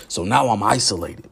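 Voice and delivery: ordinary talk, nothing marked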